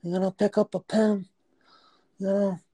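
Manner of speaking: high, mock mob godfather voice. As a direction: play a hammy old godfather. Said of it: "Godfather Don".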